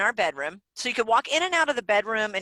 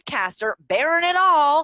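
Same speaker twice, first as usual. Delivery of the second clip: excited proclamation; 6 semitones higher, 6 dB louder than usual. Retold or imitated. Podcaster retelling a big idea to husband, excited proclamation. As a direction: proclaim it like a grand title.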